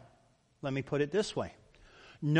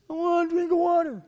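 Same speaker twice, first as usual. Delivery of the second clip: much higher, louder whiny demand; demanding child; 12 semitones higher, 6 dB louder, faster than usual. Shown as a demonstration. A child demanding water from a parent, high, loud and whiny. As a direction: whine it like a bratty child. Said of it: "you know how tone of voice says everything".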